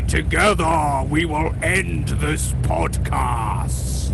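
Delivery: slow Darth Vader voice through a fan. Acted. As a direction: intone it slowly like Vader. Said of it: "now say".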